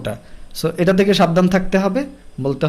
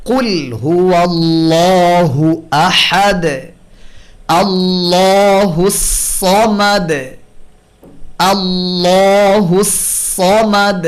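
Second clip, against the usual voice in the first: slow, drawn-out chanted recitation; Quran recitation; 3 dB louder, much slower than usual. Shown as a demonstration. Tajweed teacher reciting the verse as a model: slow Quran recitation. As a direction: recite it slowly and melodically.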